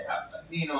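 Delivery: ordinary talk, nothing marked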